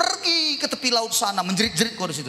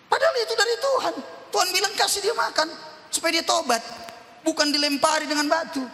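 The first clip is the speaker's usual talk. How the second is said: preaching climax; 8 semitones higher, faster than usual. Acted. Preaching climax, high fast.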